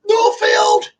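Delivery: high, bright call of her home town; proud excited shout-out; excited exclaim. Real, not acted. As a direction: blurt it out with delight. Excited answer to where from.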